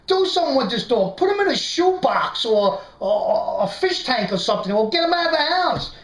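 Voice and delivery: comic outrage, peaking high at the end; retold flip-out. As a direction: order the friend to deal with it, worked up. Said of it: "I flip out. I'm yelling at the dog".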